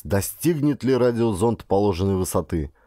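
ordinary delivery, nothing marked

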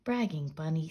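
usual speaking voice

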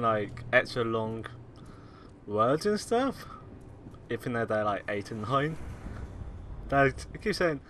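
usual speaking voice

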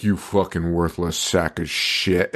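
low, soft, slow contempt; snarling Herman. Retold or imitated. Herman dismissing Grandpa with low slow contempt. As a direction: sneer it down at him. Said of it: "And Herman's like".

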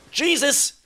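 loud, high panicked outcry; real alarmed shout. Real, not acted. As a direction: yell it as the fight turns. Panic in fight.

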